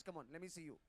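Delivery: normal talking voice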